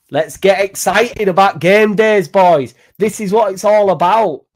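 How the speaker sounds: loud, high and slow hype for the lads; excited rallying. Real, not acted. Fan rallying the boys before the Bournemouth game, loud excited hype. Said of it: "This is how excited it can, you can be".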